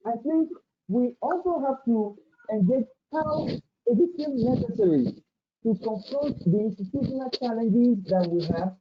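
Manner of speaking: normal talking voice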